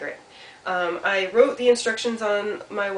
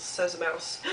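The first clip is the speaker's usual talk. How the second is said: ordinary delivery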